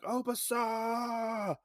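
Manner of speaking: high, long drawn-out cry of a name; calling out. Retold or imitated. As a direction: call across a big house, stretching the last vowel. Hero calls out her name.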